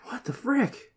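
whisper. Real, not acted. Shocked reaction to sad animation.

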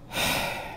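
long audible exhale before conceding; resigned sigh. Acted. Admiral yields to the doctor, with a resigned sigh. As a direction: sigh heavily as you give in.

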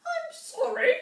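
high, loud chirpy rabbit voice; a rabbit chirping out an apology; twittering rabbit. A narrator's reading. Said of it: "twittered Rabbit".

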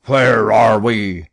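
slow, loud furious demand; captain Speedy's furious question; angry captain. A narrator's reading. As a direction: bark it out, barely holding the rage. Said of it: "his anger permitted him to utter".

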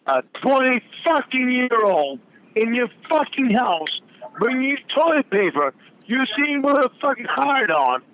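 high, heated yelling; angry rant. Real, not acted. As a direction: rant furiously, pitch climbing.

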